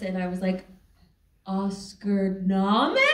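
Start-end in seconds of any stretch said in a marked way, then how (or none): 1.5-3.1 slow, exaggerated lip-reading words with a laugh; mouthing the words. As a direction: spell it out slowly, as if mouthed. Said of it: "And I'm reading lips".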